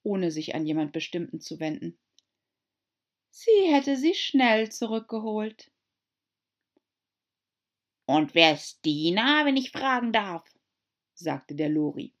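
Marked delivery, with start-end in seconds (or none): 3.3-5.7 put-on high, eager young girl voice; pleading girl reminding the mouse; Alice's voice. A narrator's reading. Alice to the mouse, high eager girl. 8.1-10.5 louder, prim lory; lory's voice. A narrator's reading. The lory to Alice, prim question.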